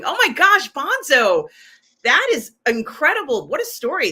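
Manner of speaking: loud, high burst of amazement; real thrilled astonishment, pitch leaping up; amazed excitement. Real, not acted. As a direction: gush at an incredible story. Amazed reaction to viewer's rocket story.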